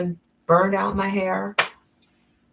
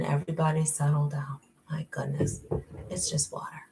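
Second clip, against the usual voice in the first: whispered, softer and lower; hushed aside to the chat; 4 semitones lower, 7 dB quieter than usual. Real, not acted. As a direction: say it under your breath. Hushing the laughing audience.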